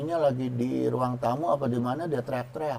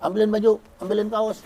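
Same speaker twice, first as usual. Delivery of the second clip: bossy husband's demand; 6 semitones higher, faster than usual. Retold or imitated. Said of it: "seenaknya aja gitu".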